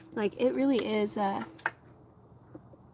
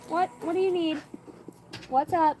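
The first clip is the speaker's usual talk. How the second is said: pet talk; 8 semitones higher, 6 dB louder than usual. Real, not acted. Talking to the dog.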